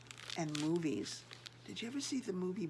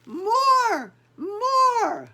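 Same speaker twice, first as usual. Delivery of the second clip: high, loud chant of "More! More!"; crowd shouting; 14 semitones higher, 15 dB louder than usual. Acted. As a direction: cheer like a crowd demanding an encore. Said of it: "voices shouting".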